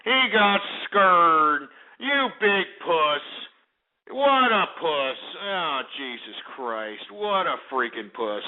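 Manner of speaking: drawn-out gloating lament; taunting sympathy that sinks into weary scorn; mock pity. Real, not acted. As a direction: stretch the words slowly to jeer at him. Gloats that caller blocked him.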